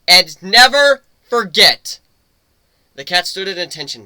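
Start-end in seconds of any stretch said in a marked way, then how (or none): 0.1-2.0 slow, much louder and higher battle cry; rallying shout. Acted. Captain leading the crew's salute, a booming slow shout. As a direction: roar it out to the whole crew.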